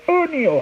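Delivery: high, long "O" in "O'Neal"; exaggerated "O'Neal"; trying pronunciation. Shown as a demonstration. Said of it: "How do you say your".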